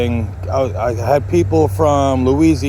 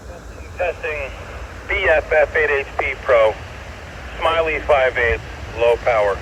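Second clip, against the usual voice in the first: heard through a radio; as if over a handheld radio; over walkie talkie. Shown as a demonstration. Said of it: "super clear".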